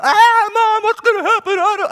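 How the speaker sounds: very high mock kid whine with a laugh; whining child. Child begging mom at the grocery store, high mock whine.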